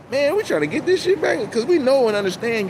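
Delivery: imitating an eager helper; eager plea. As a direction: sell the plea, pitched high.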